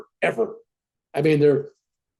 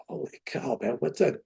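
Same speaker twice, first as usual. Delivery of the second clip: higher, soft whisper of astonishment; awed whisper; 3 semitones higher, 10 dB quieter than usual. Retold or imitated. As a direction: gasp it out in amazement. Stunned by guru's demand.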